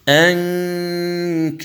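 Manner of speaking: ينظرون drawn out with the nasal hum held; slow tajweed recitation. Shown as a demonstration. Teacher demonstrating ikhfa before ظ, slow recitation. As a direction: chant ينظرون slowly through the nose. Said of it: "تقول".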